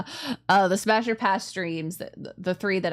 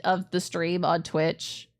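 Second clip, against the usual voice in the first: ordinary talk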